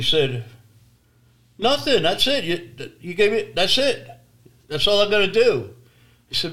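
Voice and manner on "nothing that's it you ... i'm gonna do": high, loud, dismissive retort; exasperated refusal. Retold or imitated. Mechanic shrugging off the stranded couple, loud and dismissive. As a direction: throw the refusal back in his face. Man refuses to help them.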